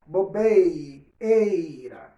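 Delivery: Bobeira slow and loud, then eira picked out; teacher isolating eira in Bobeira; stressing the diphthong. Shown as a demonstration. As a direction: slowly split off eira from Bobeira. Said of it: "Olha o meu ditongo".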